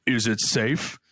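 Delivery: a voice over the store intercom, lightly done; mock intercom voice. Clerk on the intercom asks the customer, mock intercom voice. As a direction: play it like a hidden clerk on the intercom. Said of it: "over, like, an intercom".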